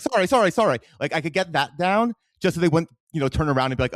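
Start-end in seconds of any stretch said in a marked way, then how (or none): 0.0-0.8 an American's mock British "sorry", much higher and louder; semi-convincing British accent. An American on the London tube apologizes in a put-on British accent. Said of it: "in a, like, a semi-convincible accent".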